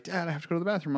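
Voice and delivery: a hint of kid's plea; voiced daughter. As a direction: imitate a child asking dad. Voices daughters asking dad.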